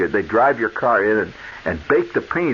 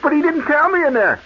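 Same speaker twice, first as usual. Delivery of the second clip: outraged protest. Retold or imitated. Customer protesting to the attendant that nobody told him. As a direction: plead that nobody told you. Customer complains of being misled.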